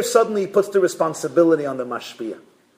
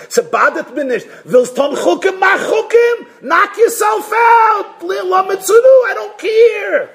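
high, loud shouted brush-off; dismissive outburst; 5 dB louder than usual. Acted. Indifferent child brushes off all questions, shouting. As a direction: wave them off at full pitch.

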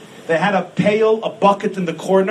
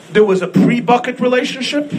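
laughing; 2 semitones higher than usual. Real, not acted. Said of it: "laughs at own joke".

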